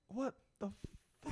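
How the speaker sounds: high, hushed stunned reaction; mock disbelief. Viewer recalling the twist to the co-host, mock disbelief. Acting out own shocked reaction.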